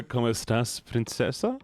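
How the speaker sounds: ordinary delivery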